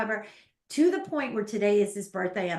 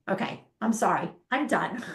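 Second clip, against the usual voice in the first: ordinary delivery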